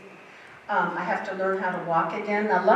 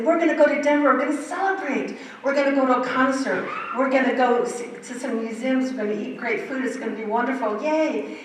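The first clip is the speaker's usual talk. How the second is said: high, bubbly, louder plans, ending on a squealed yay; excited friends; 5 semitones higher, 4 dB louder than usual. Retold or imitated. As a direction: imitate friends gushing about the trip. Friends announcing the trip excitedly.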